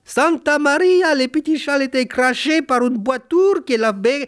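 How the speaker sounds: high, excited Spanish maid voice; Spanish accent. Acted. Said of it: "la servante ibérique".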